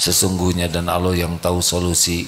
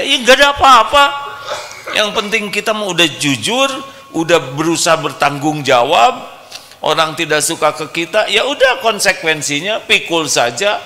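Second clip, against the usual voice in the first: much higher, louder preaching voice; fiery preaching; 11 semitones higher, 6 dB louder than usual. Acted. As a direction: preach it with full conviction. Preaches at full force. (ceramah berapi-api dengan suara tinggi)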